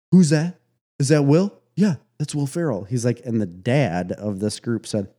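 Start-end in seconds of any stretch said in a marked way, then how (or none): 0.1-2.8 imitating loud tourists, pitched up; raised-voice, higher-pitched retold chatter; mock loud Americans. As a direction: call across like overheard American tourists. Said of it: "'Cause they're so loud".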